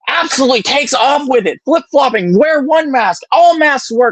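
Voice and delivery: heated, rattling off mocked flip-flopping mask orders; a little heated. Real, not acted. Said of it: "I'm getting a little heated".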